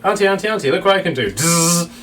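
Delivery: put-on high, eager child calling out; excited child. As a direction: call out proudly like a kid.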